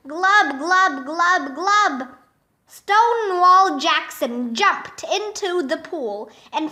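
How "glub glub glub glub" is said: mock underwater gulping; drowning glubs. A narrator's reading. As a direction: glub like someone sinking in a pool. Drowning principal goes under water.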